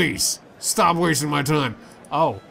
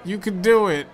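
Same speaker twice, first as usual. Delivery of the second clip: cheering on; 5 semitones higher, 4 dB louder than usual. Acted. Cheering granddad on.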